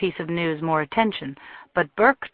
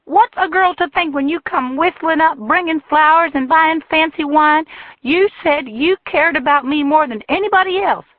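shrill, much higher furious shout; furious woman; 11 semitones higher, 6 dB louder than usual. A narrator's reading. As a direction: hurl it at him, loud and high. Jilted woman rages at Tucker.

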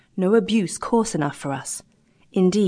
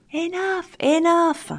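much higher outcry, a mob protesting; crying out; 11 semitones higher, slower than usual. A narrator's reading. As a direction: fling it out like a crowd cutting him off. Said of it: "cried the crew".

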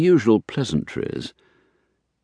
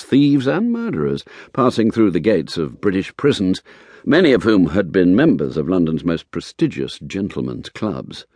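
ordinary delivery

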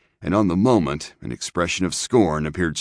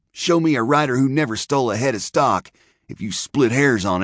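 higher, heated protest; passionate; 8 semitones higher than usual. A narrator's reading. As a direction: burst out with passion. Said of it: "ejaculated Mulhall passionately".

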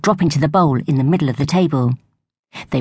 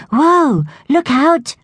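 sudden high, louder shout of alarm; alarmed warning; 11 semitones higher, 4 dB louder, much slower than usual. A narrator's reading. Evie warning friends of rolling crabapple. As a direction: warn them sharply, higher and louder.

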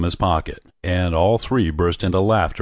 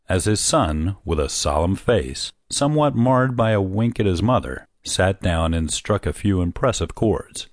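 ordinary delivery, nothing marked

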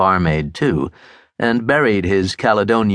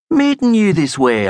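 excited; 10 semitones higher than usual. Acted. Burke excited about coincidence.

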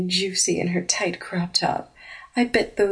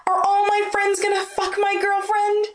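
much higher, indignant cry; much higher wounded outrage; outraged; 12 semitones higher than usual. Acted. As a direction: shriek of betrayal. Blonde outraged at betrayal.

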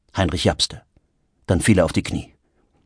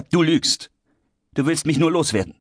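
high, quick accusing voice; hurt and indignant, pitched up; angry accusation; 8 semitones higher than usual. Acted. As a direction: fling the accusation at your friend. Acted quarrel, accusing friend.

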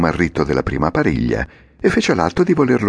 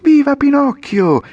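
much higher, louder yell, slow, falling from viva; crowd cheering; 6 dB louder than usual. A narrator's reading. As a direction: boys cheering the jump. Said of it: "cominciarono a urlare". (grido di evviva dei ragazzi)

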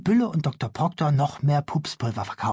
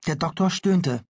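ordinary delivery, nothing marked

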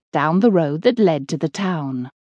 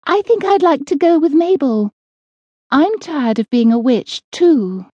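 Ethel the timid witch; 8 semitones higher than usual. Acted. Ethel sides with Mabel.

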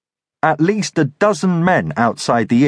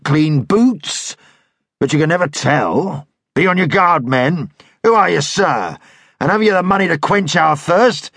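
bluff dragoon leader; 5 semitones higher than usual. Acted. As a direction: size him up with rough good humour. Dragoon sizing up Thomas.

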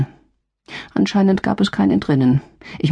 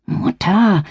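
hiss; 4 semitones higher than usual. A narrator's reading. Daughter hisses at her mother so Rainer won't hear, sharp hiss. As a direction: Mutter hissed sharply, higher. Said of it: "zischte ich zurück".

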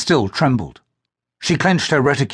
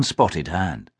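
usual speaking voice

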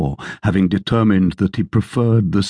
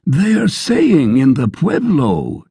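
landlord; 8 semitones higher than usual. Acted. Landlord quote in narrated story.

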